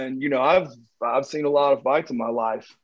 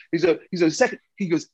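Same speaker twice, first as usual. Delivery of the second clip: usual speaking voice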